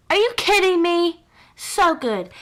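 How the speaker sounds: amazed at the swatch; delighted disbelief. Real, not acted. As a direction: react like it's too good to be true. Swatch reveal reaction.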